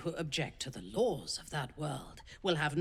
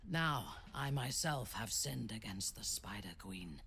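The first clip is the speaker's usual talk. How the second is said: hushed whisper, softer; whispered confession; 6 semitones lower, 7 dB quieter than usual. Acted. Drow confessing her sin against Lolth, whispered. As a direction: whisper it like a guilty secret.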